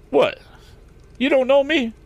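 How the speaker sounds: very high, incredulous driver; astonished. A narrator's reading. Wagon driver is amazed Morse doesn't know him, astonished. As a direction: leap up in pitch with surprise.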